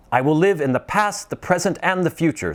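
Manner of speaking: high, bright, eager vow; joyful repeat. Acted. Scrooge repeats his vow while scrambling out of bed, joyful. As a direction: repeat it with new joy. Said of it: "Scrooge repeated as he scrambled".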